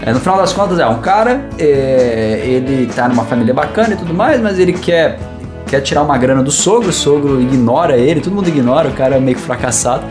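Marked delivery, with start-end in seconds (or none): none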